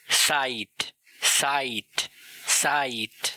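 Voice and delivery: साइट opened with ts; साइट with ts, not z; German z as ts. Shown as a demonstration. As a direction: start साइट with a ts. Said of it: "इस word को pronounce किया जाता है सेट".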